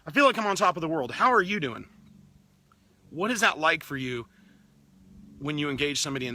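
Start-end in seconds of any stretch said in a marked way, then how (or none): 0.1-1.9 like you just crushed a morning workout; acting out a buzzing greeting after a workout; pumped-up greeting. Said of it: "or you say".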